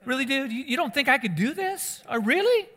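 high-pitched, incredulous "really, dude?"; mock offence, voice jumping way up in disbelief; affronted fleshly response. Preacher imagines Jesus responding like himself, offended and incredulous. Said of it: "He's got a fleshly response".

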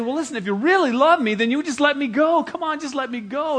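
high, loud begging to a friend; desperate plea. Acted. Defendant begging his judge friend, high and loud. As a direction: beg him to let you go.

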